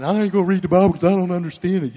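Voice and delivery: fast, loud whine; mock whining. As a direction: whine it out in a rush, mimicking a complainer. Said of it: "Some of you whine".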